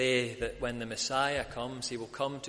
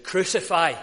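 a loud cry of the mob, louder; crowd shout; 5 semitones higher, 9 dB louder than usual. Retold or imitated. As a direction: bark it out like an angry crowd. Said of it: "who shouted".

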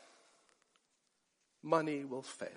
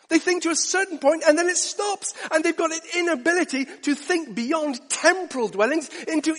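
higher and louder, driving the point home; building sermon passion; 13 semitones higher, 7 dB louder than usual. Acted. Preacher asking why people never think beyond retirement, rising passion. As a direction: climb into full preaching fervour.

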